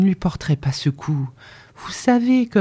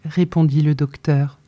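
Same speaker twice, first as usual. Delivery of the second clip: usual speaking voice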